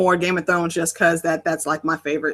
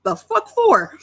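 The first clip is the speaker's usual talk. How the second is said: high, incredulous what-the-hell; baffled outburst; 14 semitones higher, slower than usual. Retold or imitated. Retelling a random subscriber to friends, high incredulous squeak. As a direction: squeal it out in disbelief. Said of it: "I was like".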